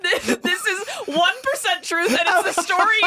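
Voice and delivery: high, loud disbelief through laughter; barely getting the words out for laughing; incredulous laughing. Real, not acted. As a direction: cry it out while cracking up. Cracking up over the lie.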